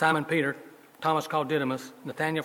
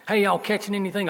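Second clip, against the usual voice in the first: higher, louder Southern call across the water; 7 semitones higher, 5 dB louder than usual. Acted. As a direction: call out "Hey, y'all" like a Southern fisherman. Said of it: "I put the southern terminology in his mouth".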